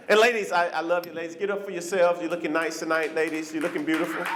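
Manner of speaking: ordinary delivery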